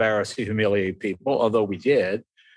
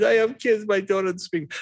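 mock tearful; 7 semitones higher than usual. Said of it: "Everything's just crying".

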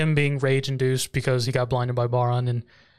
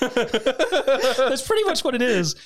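higher, slow, laughing through words; real laughter; 9 semitones higher, much slower than usual. Real, not acted. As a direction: agree while still cracking up. Laughing at co-host's joke.

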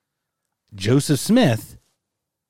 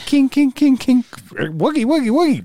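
high, louder alien babble; 13 semitones higher, 3 dB louder than usual. Pretend. Silly alien noises after UFO.

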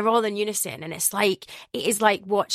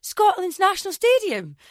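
grandpa's amazement; 12 semitones higher, 4 dB louder than usual. Retold or imitated.

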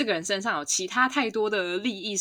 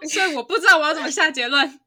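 laughing; 10 semitones higher, 10 dB louder than usual. Real, not acted. Joking about a silly conclusion.

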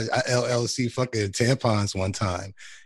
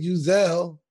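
fake French; 8 semitones higher, 4 dB louder than usual. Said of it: "we fake French over here".

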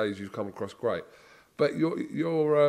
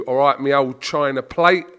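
hearty Cockney accent, loud on the last words; Cockney greeting demo; 9 dB louder than usual. Shown as a demonstration. Englishman showing what he'd say to you, Cockney demo. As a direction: greet a mate in broad Cockney. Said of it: "I'd say to you".

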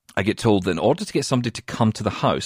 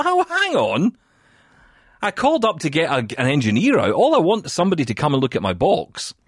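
calling out; 6 semitones higher, 3 dB louder than usual. Retold or imitated. Stopping agent from hanging up.

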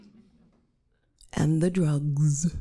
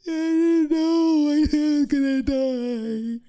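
high, slow, stretched bawling; hammed-up sobbing despair; tearful wail; 8 semitones higher, 4 dB louder, slower than usual. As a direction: bawl it out in one long note. Acted patient wailing to nurse.

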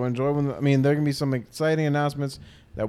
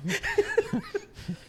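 high laugh; 6 dB quieter than usual. Real, not acted. Mishears and laughs.

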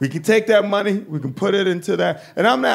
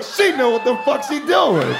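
awed; 7 semitones higher than usual. Retold or imitated. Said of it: "I was like".

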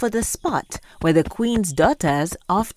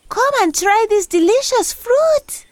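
put-on high, loud girlish princess; sweet cartoon princess exclaiming; 4 dB louder than usual. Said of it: "she exclaimed".

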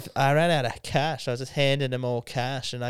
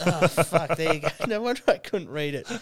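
sheepish laugh through the words; amused realisation, laughter spilling into speech; laughing at oneself; 3 semitones higher than usual. Real, not acted. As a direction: crack up at your own blunder. Laughing at own mistake reading can.

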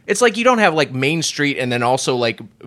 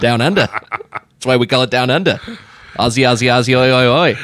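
hammed-up Aussie accent; jokey Australian twang. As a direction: cheer like a put-on Australian. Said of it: "why did the Australian guy".

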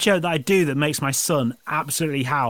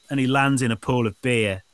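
ordinary delivery

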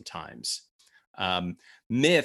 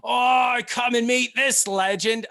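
put-on Australian accent; 13 semitones higher, 8 dB louder than usual. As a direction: gush like an Aussie mate. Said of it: "your Australian accent is legendary".

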